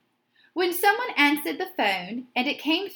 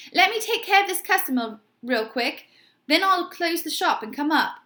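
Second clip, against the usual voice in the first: hurried; 5 semitones higher, 4 dB louder than usual. A narrator's reading. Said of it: "His talking got faster".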